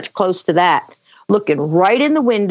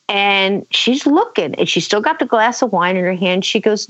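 usual speaking voice

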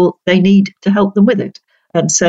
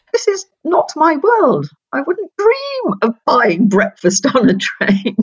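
a higher, slower mock aside, amused at a world not hers; incredulous inner thought; 10 semitones higher than usual. Student on the train to her interview, bemused and slowed. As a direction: let the thought rise up, bemused, unhurried. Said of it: "I can remember thinking".

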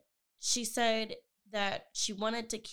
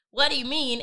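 raised, louder and higher voice; retold yell; 4 semitones higher, 7 dB louder than usual. Daughter to her dad on the phone about his answer, retold yelling. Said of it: "yelling at him on the phone".

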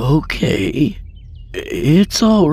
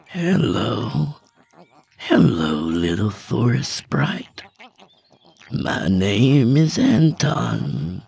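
gentle coaxing; 9 semitones higher, slower than usual. A narrator's reading. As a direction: coax a wild creature into trust. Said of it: "he said gently".

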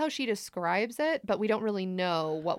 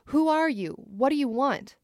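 raised, higher calling-out voice; retold yell; 6 semitones higher, 4 dB louder than usual. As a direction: call out to a stranger in the woods. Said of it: "would yell at the figure".

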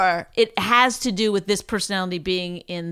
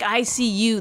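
normal talking voice

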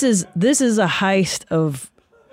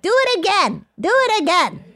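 put-on much higher eager child voice; imitating a kid begging for more; excited kid; 14 semitones higher than usual. Imitating son Julian egging brother on, high kid voice. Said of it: "I hear Julian like".

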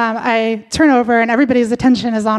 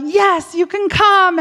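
higher mock yell; 6 semitones higher, slower than usual. Teller yells permission to partner, higher. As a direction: call it out, pitched up. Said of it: "finally I yell out".

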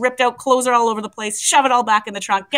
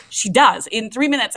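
ordinary delivery

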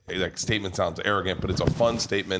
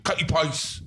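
higher, exaggerated British pronunciation; mock British accent; 7 semitones higher, slower than usual. Paparazzo repeating the name to clueless comic, mock British accent. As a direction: imitate an impatient Brit dropping the T in Katie. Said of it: "And he goes".

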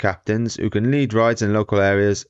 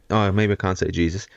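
normal talking voice

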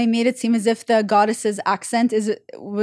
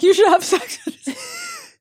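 laughing mock quote; 12 semitones higher, slower than usual. Laughing as she denies the story.

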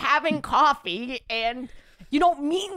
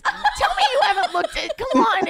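high, laughing appeal; laughing plea; 10 semitones higher, 3 dB louder than usual. Real, not acted. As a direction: plead through laughter.

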